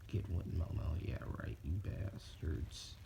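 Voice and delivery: low, drawn-out muttered jab; muttered scorn. Acted. Teller scoffing at getting with Momo, muttered scorn. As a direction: drag it out low, under your breath.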